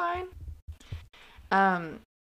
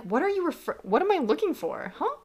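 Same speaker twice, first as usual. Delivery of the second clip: mock bafflement; 9 semitones higher than usual. Viewer answering Quinn's question on screen, mock bafflement. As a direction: search aloud for what you're missing.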